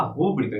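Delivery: "rúbrica" stressed on the first syllable; "rúbrica" misstressed on RU; wrong stress, RÚ-brica. Shown as a demonstration. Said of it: "não é rúbrica".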